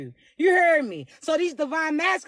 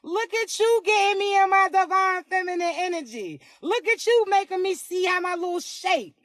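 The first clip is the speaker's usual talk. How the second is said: flirty, higher-pitched delighted gushing; 4 semitones higher than usual. Acted. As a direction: slide up high as if flattered and showing off. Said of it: "hitting them high notes like [name]".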